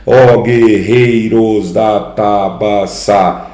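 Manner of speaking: slow, syllable by syllable; slow rhythmic recital. Shown as a demonstration. Teacher showing the poem's rhythm to students, slow. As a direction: spell out the beat slowly.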